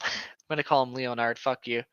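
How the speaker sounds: usual speaking voice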